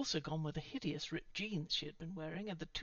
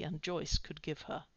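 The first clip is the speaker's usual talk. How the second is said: ordinary delivery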